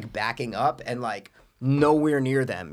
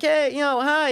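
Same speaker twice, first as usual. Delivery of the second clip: put-on kid voice. Kids answering.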